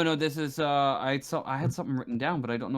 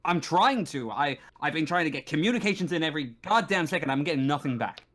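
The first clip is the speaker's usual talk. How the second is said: higher, heated and defensive marshal; exasperated station marshal; 6 semitones higher, 3 dB louder, faster than usual. Acted. Marshal answering visitors about failed contact, exasperated. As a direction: protest in frustration at being questioned.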